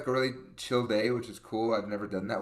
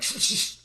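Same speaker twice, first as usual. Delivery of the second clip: sharp repeated "shh"; acted-out voiceless shushing. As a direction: hush someone talking, all breath. Retold shushing of her dad.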